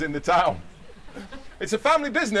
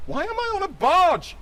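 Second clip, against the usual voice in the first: panicked character voice; 13 semitones higher than usual. Acted. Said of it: "he thought to himself".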